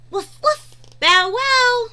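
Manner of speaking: high, loud playful barking; puppy bark. Acted. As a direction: bark like an excited little puppy. Voicing the puppies.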